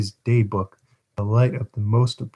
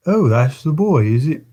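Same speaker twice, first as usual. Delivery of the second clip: a touch higher, louder greeting; lightly voiced undertaker; 4 semitones higher, 4 dB louder than usual. Undertaker eyes the orphan Bumble brought, mildly surprised. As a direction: peer at the boy, mildly surprised.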